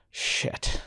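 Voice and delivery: breathless whispered shit; hushed stunned swear under the breath; whispered curse. Retold or imitated. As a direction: whisper it, thunderstruck. Stunned at first sight of girl.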